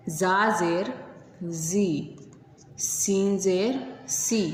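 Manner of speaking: each letter drawn out slowly, syllable by syllable; slow, patient drill of letter plus zer; slow spelled recitation. Shown as a demonstration. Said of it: "तो इसे हम इस तरह पढ़ेंगे".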